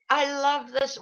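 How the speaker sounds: mock enthusiasm, pitched high; sarcastic. Real, not acted. Guest on critics of the prime minister, sarcastic. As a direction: say it with dry irony, meaning the opposite.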